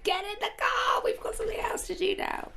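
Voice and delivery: high, rushed mock call to get moving; a light imitation of a crew hurrying her along; hurried urgency. Recalling crew rushing her between shoots, high hurried mock urgency. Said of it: "It was always like".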